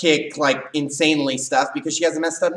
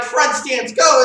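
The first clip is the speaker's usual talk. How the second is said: shouted drill command; calling a drill; 8 semitones higher, 5 dB louder, slower than usual. Retold or imitated. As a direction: bark out the drill start. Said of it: "we're like".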